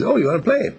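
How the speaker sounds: high, eager playful dog voice; playful young dog. Retold or imitated. Rex paws at Love wanting to play, high and eager. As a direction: invite him to play like a bouncy puppy. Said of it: "He says".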